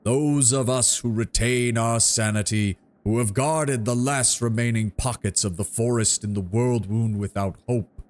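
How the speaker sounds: slow, solemn tree giant; treant voice. Acted. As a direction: draw each word out like an ancient tree. Treant's oration.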